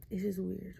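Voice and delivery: ordinary talk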